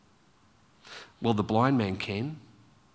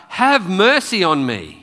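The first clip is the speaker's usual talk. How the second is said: louder, higher plea; raised-voice cry, falling at the end; 5 semitones higher, 7 dB louder than usual. Retold or imitated. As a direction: call out for mercy. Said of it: "he cries out".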